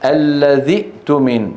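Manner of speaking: الذي ائتمن linked, a glottal stop right after dhi; connected form. Shown as a demonstration. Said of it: "هذا في الوصل". (نطق «الذي ائتمن» موصولًا بهمزة ساكنة)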